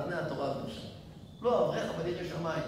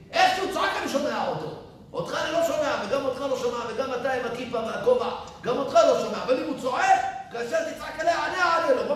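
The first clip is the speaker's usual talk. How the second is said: much higher, loud proclamation; thundering divine voice; 9 semitones higher, 6 dB louder than usual. Acted. God declaring he hears the orphan's cry, loud and high. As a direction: boom out God's answer.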